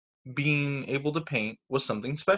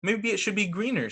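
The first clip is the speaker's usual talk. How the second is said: put-on child suggestion; 7 semitones higher than usual. Sam suggests to mother.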